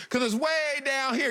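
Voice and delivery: ordinary talk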